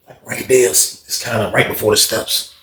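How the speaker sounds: usual speaking voice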